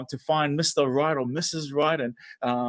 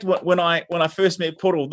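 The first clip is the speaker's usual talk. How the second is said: ordinary talk, nothing marked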